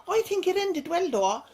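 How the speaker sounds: high-pitched, playful protest; mock indignant, high. Real, not acted. Jokey rebuttal to comment.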